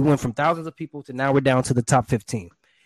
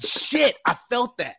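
director moved; 9 semitones higher, slower than usual. Retold or imitated. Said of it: "You know, he felt that".

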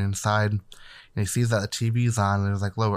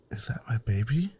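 a hushed whisper, softer; 4 dB quieter than usual. Retold or imitated. Grieving mother spots the baby, whispered. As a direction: whisper it as if unsure of what she sees.

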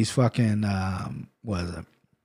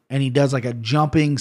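ordinary talk

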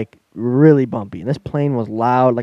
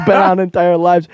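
laughing; 5 semitones higher, 5 dB louder than usual. Real, not acted. Laughing through the quote.

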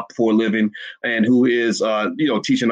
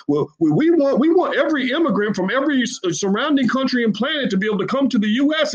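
mock politician; 11 semitones higher than usual. Mocking politicians' evasion.

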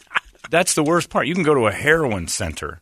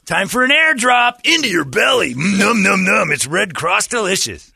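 high, loud shouted chef hype; 11 semitones higher, 6 dB louder than usual. Pretend. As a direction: bellow it like a TV chef. Mocking Guy Fieri pitch.

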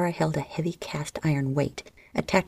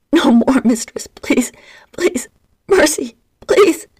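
desperate begging; 10 semitones higher, 12 dB louder than usual. A narrator's reading. Slave begs mistress to stop.

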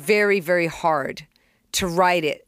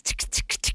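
whispered ticks; 8 dB quieter than usual. Retold or imitated.

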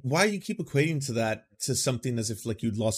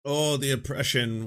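usual speaking voice

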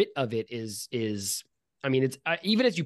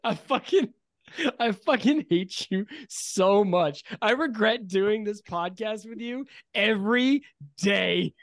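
raised, loud, drawn-out fake hatred; mock exasperated rage; 7 semitones higher, 4 dB louder, slower than usual. Host reacts to co-host's mom joke, mock exasperated rage. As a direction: lean slowly on each word in mock fury.

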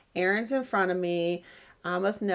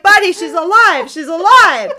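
high, loud burst of relief; relieved exclaiming; 9 semitones higher, 13 dB louder than usual. Retold or imitated. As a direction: shout the good news to the boy. Relieved shout to crying son.

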